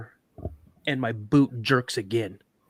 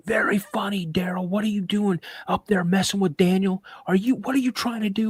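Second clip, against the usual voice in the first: imitating an annoyed uncle, much higher pitch; retold sarcastic accusation; 7 semitones higher than usual. Uncle walks out of tent to accuse dad, annoyed. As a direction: tell him off in a raised pitch.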